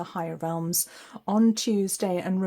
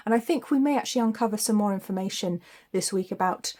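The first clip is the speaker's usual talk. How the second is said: ordinary delivery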